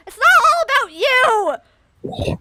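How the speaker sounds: loud, high, drawn-out cry; mock outburst. Interrupting the character's self-pity, loud mocking cry. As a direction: belt it out in hammed-up indignation.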